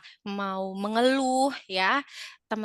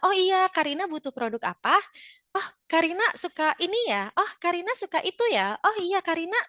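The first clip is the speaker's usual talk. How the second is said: raised pitch, eager seller's chat; attentive customer service; 6 semitones higher than usual. Shown as a demonstration. Seller using the customer Rina's name, attentive and friendly. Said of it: "sebut namanya".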